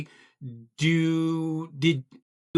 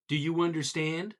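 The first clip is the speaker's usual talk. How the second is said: ordinary talk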